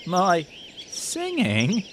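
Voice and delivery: slow, high, hurt question; slowly. Acted. Barney the sheep slowly asking about his own singing. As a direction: let it rise slowly in disbelief. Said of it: "said Barney slowly".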